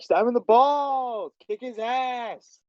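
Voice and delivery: high, slow, drawn-out hyped cheer. Shown as a demonstration. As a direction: show the actor how to cheer the stab on. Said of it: "really hyped up about it".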